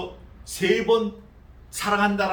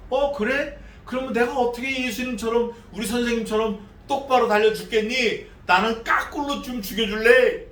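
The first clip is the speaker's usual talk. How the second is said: cheeky Peter; 6 semitones higher, 3 dB louder than usual. Retold or imitated. Peter asks to die upside down.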